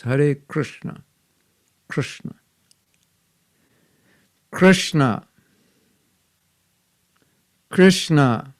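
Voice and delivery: Krishna with the SH made by the tongue curled to the roof; proper Sanskrit Krishna. Shown as a demonstration. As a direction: model Krishna clearly for the class. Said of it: "put your tongue on top of the roof when you do the S".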